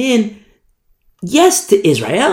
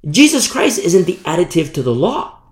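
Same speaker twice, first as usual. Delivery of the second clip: ordinary talk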